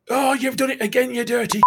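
high, pained cry of disgust; exasperated yell. Retold or imitated. Fingers catching Bob's fart again, high exasperated cry. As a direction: groan it out like you can smell it. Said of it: "Fingers go".

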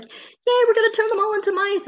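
very high, squealing glee; gleeful witch. Retold or imitated. Friend mocks the witches turning kids into mice, squeaky glee. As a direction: cheer it like a giddy villain. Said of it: "They were just like".

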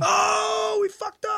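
high mock-dismay shout. Real, not acted. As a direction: yell it in comic dismay.

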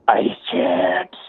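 imitation scream in a growling rasp; raspy growled scream. Caller mimicking the unresponsive patient who woke up screaming, raspy growl. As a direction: drag it out as a harsh, throaty growl. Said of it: "and screaming and".

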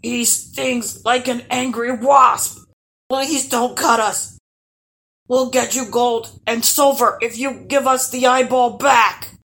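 high, loud, pleading troll; troll wailing for mercy; whimpering troll. A narrator's reading. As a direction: beg loudly as a cowed monster. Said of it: "whimpered the last troll".

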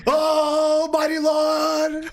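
very high drawn-out holler; slow, loud praising cry; mock preacher shout. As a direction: wail it out like a revival preacher. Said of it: "sounding like a preach".

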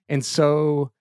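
ordinary delivery, nothing marked

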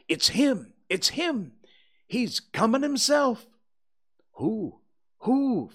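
high, loud excited cries; crowd shouting. A narrator's reading. Crowd spotting the suspect coming, shouting in alarm. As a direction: call out like many voices in a crowd. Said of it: "voices shouted".

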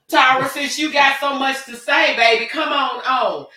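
loud, high coaxing call. Real, not acted. Host urging a viewer to join the live show, calling out.

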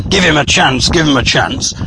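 ordinary delivery

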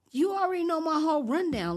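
high, loud exasperated retort; irritated. Retold or imitated. Inmate to detective who woke her up, irritated. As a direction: push back at pointless questioning, voice jumping up. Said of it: "That instantly irritated me".

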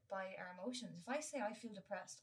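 normal talking voice

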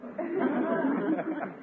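high, embarrassed chuckle; sheepish laugh. Acted. Uncle laughs off the boys' teasing, high sheepish chuckle.

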